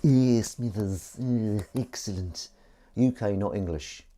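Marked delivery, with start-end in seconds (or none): 0.0-2.5 a light, slow Mr Burns voice, sly and pleased; Mr Burns impression. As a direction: savour "excellent" slowly like a scheming old boss. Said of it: "Mister Burns".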